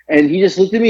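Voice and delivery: ordinary talk, nothing marked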